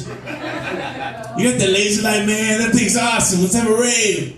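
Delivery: high, stretched-out stoner voice; zonked cat getting carried away, slowly. Acted. Stoned cat talks to owner, spaced-out enthusiasm.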